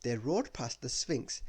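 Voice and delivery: plain reading voice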